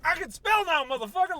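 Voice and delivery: high, loud outburst from the dog; sudden high-pitched shout from the newly literate dog. Retold or imitated. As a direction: yell it like a dog finally able to talk. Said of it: "And your dog's like".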